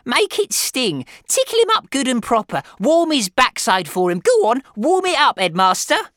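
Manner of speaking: high, loud, frenzied egging-on; excited, bloodthirsty harpy; shrieking. A narrator's reading. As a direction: screech encouragement in a mounting tirade. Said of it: "shrieked Missus Pratchett".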